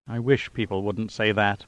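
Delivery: weary half-groan under the breath; mild groan. A narrator's reading. Pilot fending off praise, tired mild groan. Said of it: "Pitt groaned".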